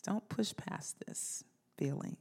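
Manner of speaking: breathy hushed plea to the congregation; whisper. Real, not acted. Pastor whispering to the congregation, gently urging. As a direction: lean in and whisper it.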